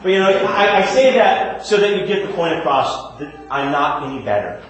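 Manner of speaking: ordinary talk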